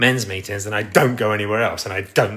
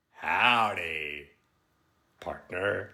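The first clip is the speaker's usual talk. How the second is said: soft, drawn-out mock American cowboy; American accent; 3 semitones lower, 8 dB quieter, much slower than usual. Streamer retrying for critics, soft slow mock American. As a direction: ease out a quieter American howdy, word by word. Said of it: "say my accent's not good now".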